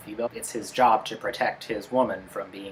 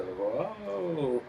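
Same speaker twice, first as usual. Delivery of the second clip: drawn-out, higher mock whoa; put-on amazement, pitched up; imitated awe; 6 semitones higher than usual. Mimicking the humans looking up at a giant, mock awe. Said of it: "having the humans go".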